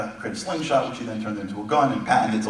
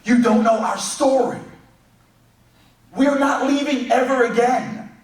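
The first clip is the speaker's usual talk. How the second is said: rousing preaching; 12 semitones higher, 4 dB louder than usual. Acted. Rousing sermon climax.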